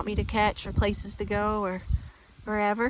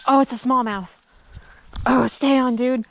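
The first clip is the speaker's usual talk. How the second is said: loud, thrilled exclamations; excited; 4 semitones higher, 9 dB louder than usual. Real, not acted. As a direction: cheer the fish on. Fighting the fish, calling out.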